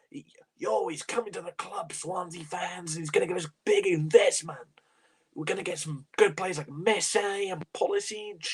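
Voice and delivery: mock-hyped, badly faked American; bad American accent. Streamer mocks promises of big investment, bad American accent. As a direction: put on a poor American accent to parody the hype. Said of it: "That's a shit American accent".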